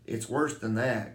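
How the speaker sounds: usual speaking voice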